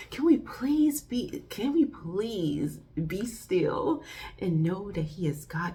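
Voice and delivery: ordinary talk, nothing marked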